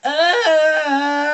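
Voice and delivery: high shrill mock shriek of an old lady; retold old lady's sudden loud scream; startled scream. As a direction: shriek like she's been splashed. Old lady startled by Pepsi spray.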